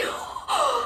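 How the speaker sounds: high, thrilled intake of breath; delighted gasp. Acted. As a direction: gasp at the memory of a kiss. Recalls the kiss in character.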